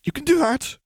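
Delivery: very high, panicked outburst; squealed, eager plea; blurted. A narrator's reading. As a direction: blurt it out in desperate agreement. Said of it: "she blurted".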